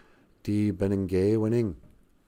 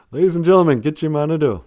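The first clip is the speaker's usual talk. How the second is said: fast, higher, loud grand introduction; showman announcer; 5 semitones higher, 6 dB louder, faster than usual. Acted. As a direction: announce him like a ringmaster.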